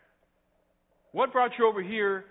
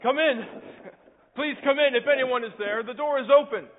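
calling out; 6 semitones higher, 5 dB louder than usual. Acted. Injured pastor calls to the visitor at the front door, calling out loud. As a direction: call out loudly to someone at the front door.

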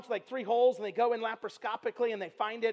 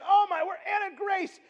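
calling out; 7 semitones higher than usual. Retold or imitated. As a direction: call her in, alarmed. Calling daughter from another room.

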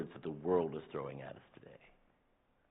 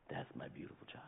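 whispered softly, like a parent over a crib; tender whisper; 16 dB quieter than usual. Acted. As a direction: breathe the words out in awe. God speaking tenderly of his child.

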